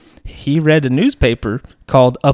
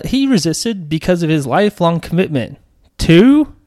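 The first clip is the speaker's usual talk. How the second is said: ordinary talk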